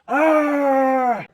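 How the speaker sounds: high, loud cry of frustration; frustrated yell. A narrator's reading. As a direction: let out a fed-up shout. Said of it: "Izzy yelled".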